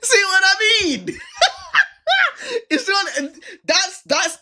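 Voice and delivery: high-pitched helpless laughter; squealing laugh through the words; laughing hard. Real, not acted. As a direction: burst out laughing, can't hold it in. Host cracks up at the joke.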